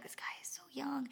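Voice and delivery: softly whispered aside; whispered thought. Retold or imitated. Thinking about the young driver, a whispered aside. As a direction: breathe it as a secret aside.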